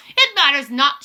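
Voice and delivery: commanding witch outburst; imperious shout. A narrator's reading. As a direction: shout it down at the crowd. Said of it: "shouted the Grand High Witch".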